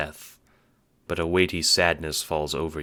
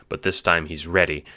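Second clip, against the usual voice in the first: ordinary talk, nothing marked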